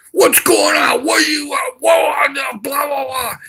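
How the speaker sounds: high, loud barrage of questions; high-pitched shouted police impression; mock police yelling. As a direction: imitate an officer yelling over someone, trailing into blah-blahs. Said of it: "start yelling at me".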